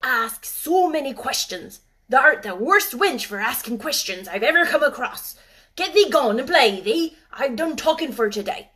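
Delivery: put-on loud, cross old gardener. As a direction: scold her crossly. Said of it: "he said it so crossly".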